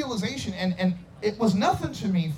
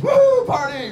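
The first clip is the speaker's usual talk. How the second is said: loud, high whoop; mock party-animal holler; 10 semitones higher, 8 dB louder, slower than usual. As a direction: cheer like a partying rocker. Mimicking party musicians.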